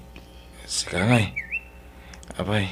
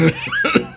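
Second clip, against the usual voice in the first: hearty laugh; 6 dB louder than usual. Acted. Wife laughs after the joke.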